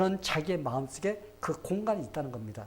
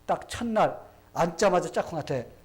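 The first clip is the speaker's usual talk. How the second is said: ordinary delivery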